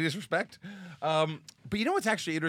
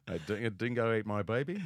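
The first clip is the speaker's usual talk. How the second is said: tentative Australian accent attempt; low, unsure stab at an Australian accent; 5 semitones lower than usual. Co-host asked to demonstrate, hesitant Australian accent. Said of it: "I wanna hear your Australian accent".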